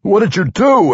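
high, startled outburst. A narrator's reading. Barbarian questioning halfling after the blast, startled. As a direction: ask in shock, voice jumping up. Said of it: "a startled Wulfgar asked".

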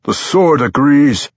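high, slow, strained utterance; through chattering teeth. A narrator's reading. Anzarel struggling to hold the sword, teeth chattering. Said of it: "said through chattering teeth".

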